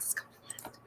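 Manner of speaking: a hushed whisper; whispered repeat. Real, not acted. Telling viewers her wigs are wearing out, in a whisper. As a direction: whisper it to yourself.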